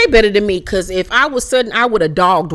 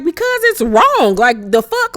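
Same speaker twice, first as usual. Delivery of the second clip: much higher, slowed indignant cry; indignant outburst; 9 semitones higher, slower than usual. Retold or imitated. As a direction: plead it like it's obvious. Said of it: "she was like".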